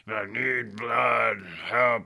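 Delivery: slow, loud mock-brute bellow; dumb Hulk Bane. Podcaster mocking nineties movie Bane as a dumb brute, slow mock shout. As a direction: play Bane as a dumb lumbering Hulk, slowly. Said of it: "a dumb Hulk with a lucha mask".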